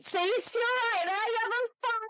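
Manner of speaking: high put-on copper voice calling out; mock police voice. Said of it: "Just this booming voice behind them".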